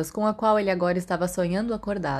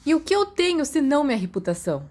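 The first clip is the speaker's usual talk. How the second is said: pitched up with a grin; grinning; 8 semitones higher than usual. A narrator's reading. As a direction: say it smiling back at him. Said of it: "Sirius sorriu de volta".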